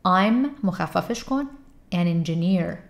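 ordinary talk

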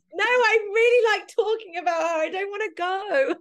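mock plead not to stop; playful protest. Real, not acted. Begging host not to end the podcast, laughing whine.

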